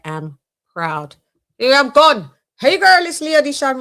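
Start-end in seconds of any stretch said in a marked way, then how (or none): 1.6-3.8 high loud upbeat goodbye; sign-off. Acted. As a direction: sing out the exit line. Sign-off catchphrase.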